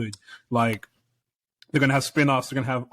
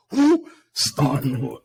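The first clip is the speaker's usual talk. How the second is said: confused character voice; 4 dB louder, much slower than usual. Retold or imitated. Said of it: "He's like".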